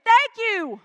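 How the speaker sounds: loud, high, full-throated thanks; a rousing church shout of thanks; shouted thank you. Shown as a demonstration. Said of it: "shout it like you mean it".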